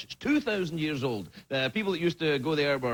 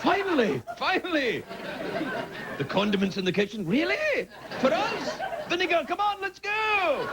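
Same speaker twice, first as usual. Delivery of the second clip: hammed-up high condiment cheer; excited condiments; 11 semitones higher than usual. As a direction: play the ketchup bottles thrilled at their chance.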